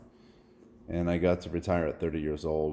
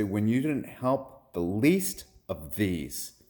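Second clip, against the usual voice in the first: slow, higher, heavily weighted words; preached rebuke; 4 semitones higher, much slower than usual. Retold or imitated. Lord answers those on his left, solemn rebuke.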